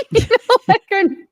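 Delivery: high laughing squeal through the words. Real, not acted. Laughing while explaining herself.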